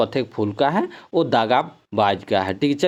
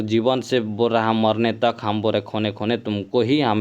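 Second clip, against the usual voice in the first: ordinary delivery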